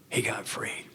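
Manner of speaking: he got free, breathed softly; hushed whisper. Real, not acted. Telling the room his sponsee got free, whispered. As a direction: let it fall to a whisper.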